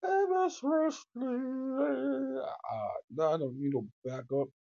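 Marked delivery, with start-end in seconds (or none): 0.0-2.4 lightly voiced [name] croon; a slow, drawn-out [name] impression; a hint of [name], stretched out. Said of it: "like I'm [name]".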